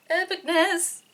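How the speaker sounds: high gleeful Epicness; excited awe. Real, not acted. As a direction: hit Epicness high and thrilled. Repeated word, two takes.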